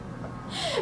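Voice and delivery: soft, high giggle; real laugh. Real, not acted. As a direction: giggle quietly at your own story. Laughs at own guilty question.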